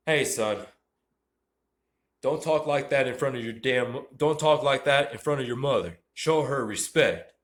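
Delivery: loud, scolding father; stern dad. Acted. Dad scolding his son at the dinner table, loud and stern. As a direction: scold him like an angry dad. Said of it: "All right, the dad".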